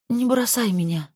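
high, drowsy pleading murmur; sleepy. Acted. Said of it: "сонно сказала Зоя".

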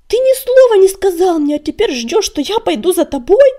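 loud, high, outraged question; indignant. A narrator's reading. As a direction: throw it back in disbelief and anger. Indignant refusal, snorts afterwards.

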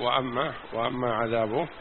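feed the reader the line under your breath; quiet prompt. Real, not acted.

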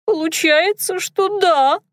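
high, fast squeaky maid; squeaky girl voice. A narrator's reading. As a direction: chatter in a thin squeak. Dunka squeaking.

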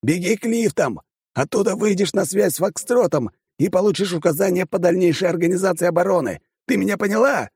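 high urgent yell in her ear. Acted. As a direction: shout orders over the noise. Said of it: "он проорал на ухо напарнице".

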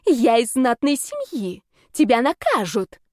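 slow, mildly wailing threat; drawn-out indignant warning; howled protest. A narrator's reading. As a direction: wail it in a put-on alarmed voice. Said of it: "взвыла Мамия".

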